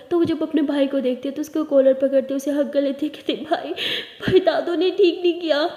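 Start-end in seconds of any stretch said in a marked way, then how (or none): 3.3-5.6 higher, louder sobbing complaint. Acted. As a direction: cry out the hurt to your brother. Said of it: "बहुत रो रही होती है".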